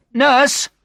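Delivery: high, loud call for help. A narrator's reading. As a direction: call for the nurse down the corridor. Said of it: "I heard her call".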